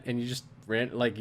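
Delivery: ordinary talk, nothing marked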